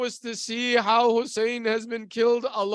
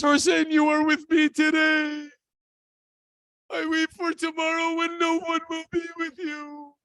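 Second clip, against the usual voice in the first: raised, anguished cry of a dying brother; tearful lament; 4 semitones higher than usual. Retold or imitated. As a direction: wail it out in grief, trailing away. Dying brother weeps for Hussein.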